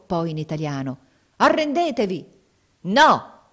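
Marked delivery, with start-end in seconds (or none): 1.4-2.2 high, loud demand; thundering shout. A narrator's reading. Austrian calling on defenders to surrender, thundering shout. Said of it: "voce tonante gridò". 2.8-3.5 one high, very loud cry; yelled refusal. A narrator's reading. Captain refusing surrender from a window, yelled refusal. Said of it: "urlò il capitano".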